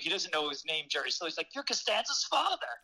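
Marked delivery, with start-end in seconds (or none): 1.5-2.7 surprised recognition. Retold or imitated. Homeowner recognizing Stiller.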